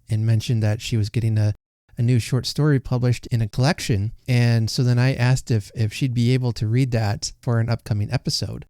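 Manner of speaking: loud, steady announcer read; podcast host intro. Acted. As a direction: present the story to listeners. Formal podcast story intro.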